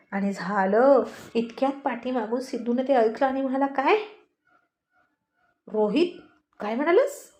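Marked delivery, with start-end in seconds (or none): 3.7-7.3 rising, higher astonished question; surprised. A narrator's reading. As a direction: catch him out in disbelief. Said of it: "Sidhu चकित झाला". (आश्चर्याने विचारलेला प्रश्न)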